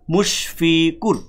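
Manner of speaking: মুশফিকুর said very slowly, ending closed on কুর; slow syllabified demo; syllable by syllable. Shown as a demonstration. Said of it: "কুর বললে আপনাকে মুখটা অফ করতে হচ্ছে".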